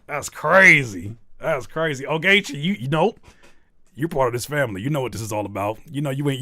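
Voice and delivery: normal talking voice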